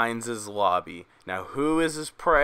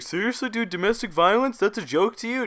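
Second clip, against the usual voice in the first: much higher whiny voice, imitating outraged viewers; mocking offended viewer; 9 semitones higher than usual. Imitating viewers upset about the violence joke, high whiny mockery. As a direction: parody the offended commenter. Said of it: "I know a couple of you guys are like".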